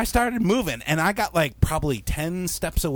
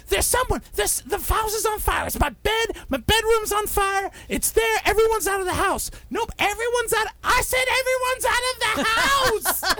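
high, urgent frantic voice; frantic homeowner. Retold or imitated. Homeowner telling dispatcher, high frantic voice. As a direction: rattle it off in a panic.

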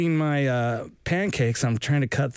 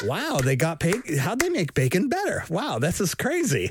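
put-on amazed kid; 8 semitones higher than usual. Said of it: "I was like".

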